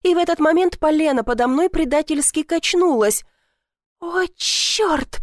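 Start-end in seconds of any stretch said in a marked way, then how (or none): 4.0-5.2 hissed curse. A narrator's reading. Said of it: "прошипела".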